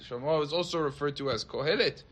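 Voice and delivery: usual speaking voice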